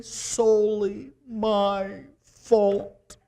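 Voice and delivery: high, very slow, loud mock wailing apology; hammed-up high self-pitying lament; mock self-blame. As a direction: overdo the guilt, drawn out. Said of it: "nor did Mary blame herself".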